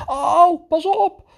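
high, playful "watch out" in the game; mock alarm. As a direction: warn him of a pretend danger. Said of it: "ik kan natuurlijk wel zeggen".

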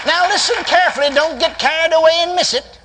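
high, emphatic pulpit storytelling; climactic preaching. Real, not acted. Preacher telling how the spirit hit the floor, high climactic preaching.

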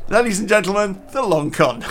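laughing announcement. Real, not acted.

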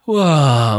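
loud exhaled "uah" of release; floating sigh. Retold or imitated. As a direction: sigh out as if lifting off. Acts floating up in relief.

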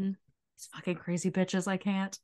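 muttering despair under the breath; whispered aside of a worn-out kid; mock exasperated whisper. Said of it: "younger brother's in the background".

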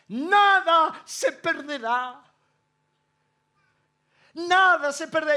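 slow, high and loud, savoring each word; exalted proclamation. Retold or imitated. Preacher proclaiming God's promise to the congregation, slow and exalted. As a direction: proclaim the promise slowly, lifted up.

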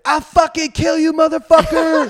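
very high, loud imitated threat; mock furious shout. Man with bat to stranger at door, mock furious shout. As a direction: yell it as a startled, enraged neighbor.

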